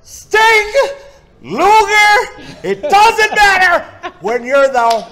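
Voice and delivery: huge high-pitched wrestling promo shout; Ric Flair. Acted. Performer trying the impression at host's request, screaming wrestler promo. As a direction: holler it like a ranting champion wrestler. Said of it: "do a little Ric Flair".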